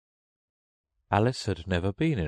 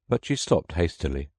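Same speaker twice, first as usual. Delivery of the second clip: ordinary delivery, nothing marked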